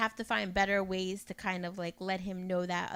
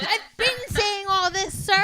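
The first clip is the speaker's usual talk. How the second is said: slow, much higher and louder mock exasperation; exasperated; 10 semitones higher, 8 dB louder, slower than usual. Girlfriend telling boyfriend she already said it, slow exasperated. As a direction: drag it out, fed up with him. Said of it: "I'm like".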